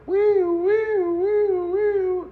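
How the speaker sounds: very high-pitched siren wail; imitated ambulance siren. Man recalls hearing the ambulance come, imitated siren. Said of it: "The ambulance come".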